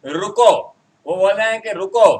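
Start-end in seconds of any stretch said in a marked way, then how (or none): 0.0-0.5 loud "रुको", then a halt; punched "रुको" with pause after; stop at the comma. Shown as a demonstration. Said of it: "comma लगने के बाद". 1.8-2.1 loud, emphatic "रुको"; "रुको" set off as a command; stop at the comma. Shown as a demonstration. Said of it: "comma लगने के बाद".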